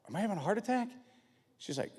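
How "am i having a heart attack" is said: high, worried question; mock alarm. Husband fears his heart, asks wife in mock alarm. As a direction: imitate a man starting to panic.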